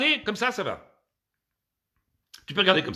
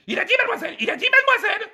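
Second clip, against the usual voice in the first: very high mock-female shriek; outraged woman; 4 dB louder, faster than usual. Woman repeating her complaint to police, outraged shriek. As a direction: squeal the accusation, hammed up.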